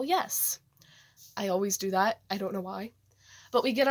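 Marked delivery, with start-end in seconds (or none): 0.0-0.6 yell. Retold or imitated. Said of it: "And I yell".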